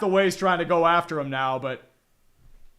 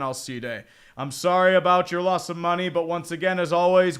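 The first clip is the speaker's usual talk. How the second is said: ordinary talk, nothing marked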